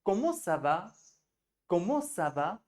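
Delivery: Comment ça va with a rising question tone at the end; question tune. Shown as a demonstration. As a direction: ask "Comment ça va?" lifting the voice like a question. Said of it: "थोड़ा सा question tone लगाना है". (प्रश्न वाली लय में)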